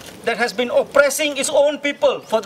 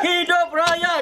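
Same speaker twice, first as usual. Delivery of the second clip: fiery revolutionary speech; 5 semitones higher than usual. Real, not acted. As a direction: chant it out to the crowd. Leads protest chant call.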